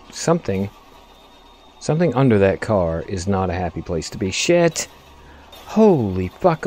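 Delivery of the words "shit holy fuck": sudden startled outburst, higher and louder; startled swearing. Real, not acted. Driving near a wreck, startled loud swearing. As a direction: yelp the curse as the truck nearly flips. Near crash under car.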